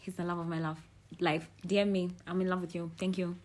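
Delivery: ordinary talk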